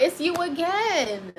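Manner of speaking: high, drawn-out phone greeting; mock exasperation. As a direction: greet the unwanted caller, dragging out the words. Acted answer to constant caller.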